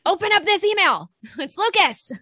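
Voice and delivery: high, loud, half-laughing student yell; mock yelling student. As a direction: bark it at the teacher like a pushy kid. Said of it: "getting yelled at".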